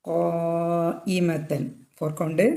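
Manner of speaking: ഖായിമത്തൻ with the long vowel held a full four counts, slowly; stretched vowel. Shown as a demonstration. Tajweed teacher showing students the four-count stretch on ഖായിമത്തൻ. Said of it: "നിർബന്ധമായിട്ട് നീട്ടുക".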